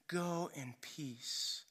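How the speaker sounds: very soft, slow, near-whispered tenderness; intimate blessing. Retold or imitated. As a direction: hush it and draw it out gently. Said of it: "intimate connection with him".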